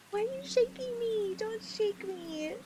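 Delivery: high, slow fake sob of protest; mock crying whine. Streamer whining at chat for shaking her, mock crying. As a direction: whimper it like being shaken, hammed up.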